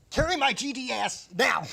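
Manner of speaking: high, loud shouted demand; imitated furious yell. Retelling the injured manager snapping at his helper, high mock-furious shout. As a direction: bellow an order in mock agony, playing it up.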